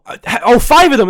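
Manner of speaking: high, loud incredulous reaction; acted shocked disbelief. Friend learns about the pills, imitated loud disbelief. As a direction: blurt it out loudly, imitating a stunned friend.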